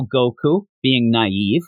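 normal talking voice

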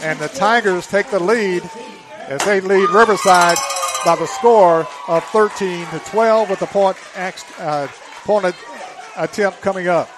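loud, thrilled announcer; excited play-by-play. Real, not acted. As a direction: keep the energy up after the score. Excited follow-up after the score.

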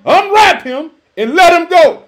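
loud, high preaching proclamation; triumphant proclamation. Acted. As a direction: ring out the command to the crowd. Preacher proclaims Jesus' command triumphantly.

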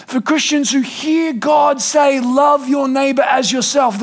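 slow and lifted, calling out the command. Retold or imitated. Pastor quoting James to the church, slow and rising to a shout. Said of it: "He said".

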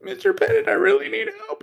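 put-on very high pleading girl voice. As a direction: squeak it like a young student. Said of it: "girl ask me".

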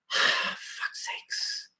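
breathy, under-the-breath grumble; exasperated sigh. Retold or imitated. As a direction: sigh it out, voiceless and fed up. Voicing the partner's grudging reaction.

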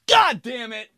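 high burst of frustration; mock exasperation. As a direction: curse yourself for slipping up. Fails to stop himself ordering.

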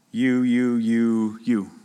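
low, evenly hammered "you, you, you, you"; pointed, measured repetition of "you"; deliberate repeated YOU. Shown as a demonstration. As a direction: land separately on each "you". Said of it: "The author does that on purpose".

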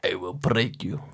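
very low, quiet, heavy Russian voice; deep menacing boxer in a Russian accent; bad Russian accent. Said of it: "I'm gonna try it".

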